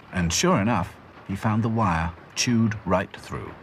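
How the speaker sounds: plain reading voice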